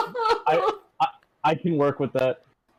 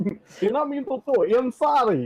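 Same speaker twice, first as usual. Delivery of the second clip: high, panicky apology in the wizard voice; a clueless necromancer squeaking excuses; sheepish wizard. Acted. Wizard apologizing for killing kids.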